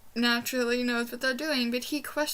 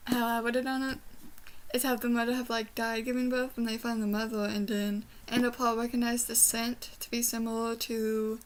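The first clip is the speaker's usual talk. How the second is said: ordinary talk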